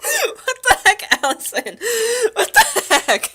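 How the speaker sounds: high, loud, breaking up with laughter; shocked laughter. Real, not acted. Streamer scolding Allison's remark, helplessly laughing. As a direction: exclaim through a real laughing fit.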